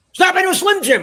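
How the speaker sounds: loud, high-pitched wrestler holler; wrestler catchphrase impression. Storyteller mimicking the wrestlers he hoped to film, hollered catchphrase.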